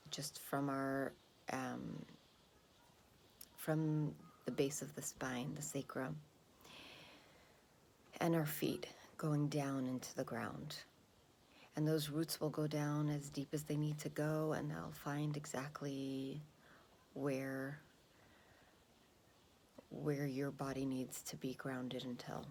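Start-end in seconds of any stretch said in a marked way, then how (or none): 0.1-13.5 calm grounding exercise. Acted. Meditation instruction. 14.5-22.5 calm grounding exercise. Acted. Meditation instruction.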